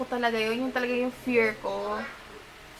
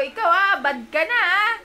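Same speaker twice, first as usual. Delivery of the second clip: mock scolding; 9 semitones higher, 9 dB louder than usual. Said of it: "Sabi ko".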